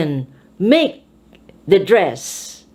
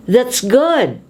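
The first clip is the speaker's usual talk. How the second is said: "good" raised, then falling; stress on GOOD; 3 semitones higher than usual. Shown as a demonstration. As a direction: put force into "good". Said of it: "put force into adjectives".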